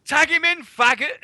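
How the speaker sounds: high, loud crowd yell; imitated heckler's shout. Imitating someone shouting at Miz, high loud heckle. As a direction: holler it like an impatient wrestling fan. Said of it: "Somebody actually shouted at Miz".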